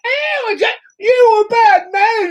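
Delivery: high, loud crying Cockney wail. Retold or imitated. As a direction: wail it like the flower girl. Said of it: "I was like".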